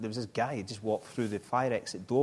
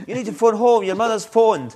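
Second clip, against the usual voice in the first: sergeant major on parade; 10 semitones higher, 12 dB louder than usual. Retold or imitated. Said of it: "He said".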